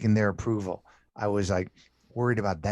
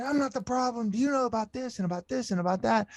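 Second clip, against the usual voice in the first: much higher, mock indignant protest; retold indignant protest; 14 semitones higher than usual. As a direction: defend yourself, hammed-up outrage. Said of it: "And I got all angry".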